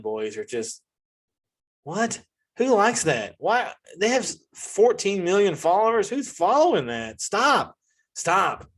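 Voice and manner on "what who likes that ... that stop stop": high, disbelieving outburst; can't believe anyone watches this; incredulous rant. Real, not acted. Ranting to viewers about Island Boys, incredulous.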